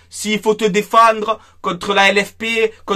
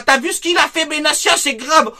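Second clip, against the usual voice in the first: high, whiny gossip voice; mocking rival fans; 8 semitones higher than usual. Mimicking rival club fans gossiping about Benassia, high and whiny. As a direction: parody the rival fans' outrage. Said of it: "en train de dire Benassia".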